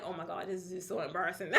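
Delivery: soft and low, laughing through it; mortified giggle under your breath; embarrassed laughing. Retold or imitated. In a hotel hallway as poles crash, laughing softly. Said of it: "I'm like".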